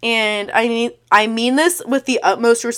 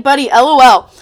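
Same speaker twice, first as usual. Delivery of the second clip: sneering, louder L O L; loud scoffing spelled-out lol; loud mocking L O L; 3 semitones higher, 8 dB louder than usual. Real, not acted. Mocking his message.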